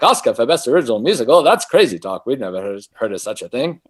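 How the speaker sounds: mock pompous Hollywood elder, quick and loud; stuffy Academy bigwig. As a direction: puff up like a self-important studio head. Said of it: "they all talk like this".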